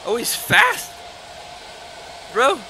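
loud high frantic yelling; panicked yelling. Real, not acted. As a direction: shout in a panic while fleeing. Fleeing a fast mob.

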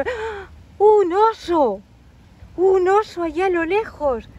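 startled exclamation. Acted. Storyteller revealing a bear in the distance, loud surprised cry. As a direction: exclaim it like a sudden sighting.

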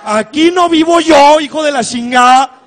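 laughing loud cursing protest; laughing yell. Retold or imitated. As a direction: scold the ex at full volume.

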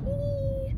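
high-pitched "whee" squeal; excited whee. Real, not acted. Heading off on the trip, excited squeal. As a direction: let out a high gleeful whee.

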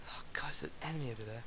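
hushed quick whisper. Real, not acted. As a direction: whisper so the enemy won't hear. Spots enemy in game.

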